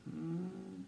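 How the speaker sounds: soft sleepy murmur; a drowsy hum from bed; murmured hmm. A narrator's reading. Feverish boy answering the mouse, soft murmur. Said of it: "murmured Keith".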